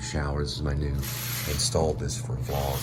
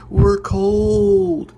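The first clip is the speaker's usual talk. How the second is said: very high, loud whiny "We're cold"; whiny family; 10 dB louder than usual. Retold or imitated. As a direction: wail it like a freezing mom. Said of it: "And they'd say".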